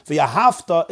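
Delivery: V'ahavta with no vowel before the T; silent shva in V'ahavta. Shown as a demonstration. Teacher naming the resting shva, silent in V'ahavta. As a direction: run V straight into T in V'ahavta. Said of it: "is a shvanach".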